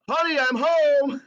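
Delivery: high, loud, slow call into the house; playful yell. Pretend. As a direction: call out as if you own the place. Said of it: "I yell out".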